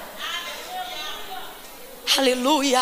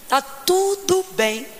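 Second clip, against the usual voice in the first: serene calm; much slower than usual. Acted. Calm reply despite dead son.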